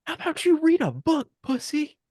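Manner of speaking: very high mock outburst; imitating a gamer's outburst; triumphant taunt. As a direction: jeer it in a strained high voice. Retold outburst after slamming controller.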